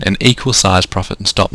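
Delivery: usual speaking voice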